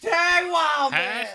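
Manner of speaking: high, stretched mock yell; Chuck Swirsky. Host imitating an announcer's catchphrase, high mock yell. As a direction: call it out high and slow, without extra volume. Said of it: "he would yell".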